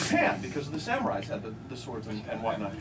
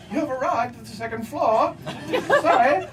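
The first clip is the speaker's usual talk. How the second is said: higher, prim announcement voice; talking elevator; 8 semitones higher than usual. Retold or imitated. Comic imitating an English elevator announcing floors, higher and prim. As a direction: play a polite talking lift. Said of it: "they were like".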